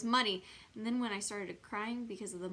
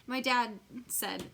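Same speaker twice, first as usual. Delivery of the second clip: ordinary delivery, nothing marked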